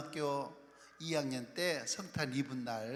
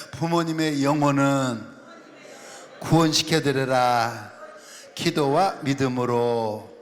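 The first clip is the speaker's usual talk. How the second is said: louder, projected line to be repeated; louder call-out; 12 dB louder, slower than usual. Shown as a demonstration. Preacher leading the congregation to repeat after him, louder. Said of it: "좀 더 크게".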